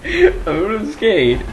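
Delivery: high, slow eager voice; hopeful kid about to skate; excited retelling. Retold or imitated. Retelling her plan to skate, excited. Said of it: "'cause I was like".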